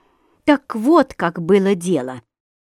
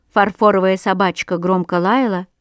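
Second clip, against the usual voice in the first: usual speaking voice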